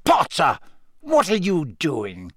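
teacher catching a pupil out; stern challenge. A narrator's reading. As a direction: rap out the name, then draw out the question. Snape catches Harry eavesdropping.

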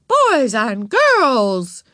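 loud, drawn-out high call. A narrator's reading. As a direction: call a noisy class to order. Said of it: "Miss Robbins raised her voice".

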